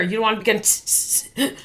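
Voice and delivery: short broken tss hisses; choppy hiss bursts. Shown as a demonstration. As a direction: spit out little tss bursts. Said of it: "don't do it".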